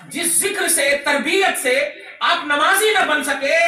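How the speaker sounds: high, impassioned sermon delivery; preaching at full force. Real, not acted. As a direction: drive it home to the congregation. (जोश भरी ऊँची तक़रीर)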